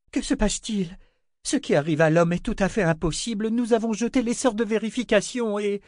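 high, agitated questioning; worked up and rushing; flustered. Acted. Armina in a state, high and agitated. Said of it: "dit Armina dans tous ses états".

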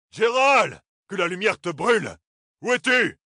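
cris aigus et forts; appelle-le en jurant; appel furieux. A narrator's reading. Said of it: "il continua à l'appeler". (Toram crie après Gérald, furieux)

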